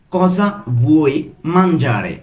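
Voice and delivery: slow, separated "Cosa ... vuoi ... mangiare?"; broken into pieces. Shown as a demonstration. Teacher asking what you want to eat, word by word. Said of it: "আমি কিন্তু ভেঙে ভেঙে বলছি".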